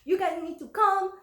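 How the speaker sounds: mock-furious neighbor calling nine one one; screaming on phone. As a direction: complain to the dispatcher at full pitch. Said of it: "raving and screaming".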